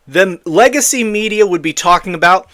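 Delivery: normal talking voice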